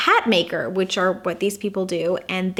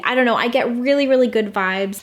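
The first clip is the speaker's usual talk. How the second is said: ordinary talk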